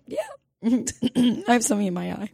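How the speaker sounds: high, quiet voice holding back crying; mock tearful. Denying tears to the intake caller, mock tearful. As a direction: feign composure through tears. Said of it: "I started crying on the phone".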